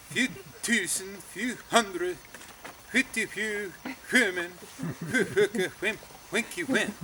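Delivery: high, singsong mock Swedish gibberish; fake Swedish. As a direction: rattle off pseudo-Swedish, laughing at the end. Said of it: "He could fake Swedish very good".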